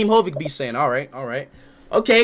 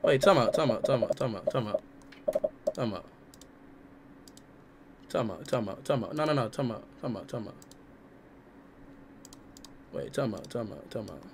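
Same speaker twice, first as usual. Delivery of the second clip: "time out" repeated, lower and softer each time until near silent; fading stunned mutter; 13 semitones lower, 16 dB quieter, faster than usual. Real, not acted. Streamer stunned by the word, fading out. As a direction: trail off in disbelief.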